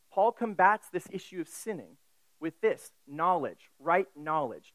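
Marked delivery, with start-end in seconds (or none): none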